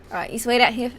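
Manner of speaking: put-on NPC line in an Australian accent; a casual Australian accent. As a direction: give the ghoul an Australian accent. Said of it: "I don't know why I gave her that accent".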